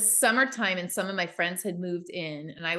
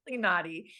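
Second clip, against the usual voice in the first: normal talking voice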